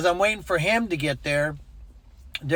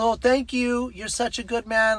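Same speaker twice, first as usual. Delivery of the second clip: high-pitched gushing praise; pitched-up retold gratitude; grateful woman, imitated; 7 semitones higher than usual. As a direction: mimic her thankful farewell. Grateful woman voiced in retelling.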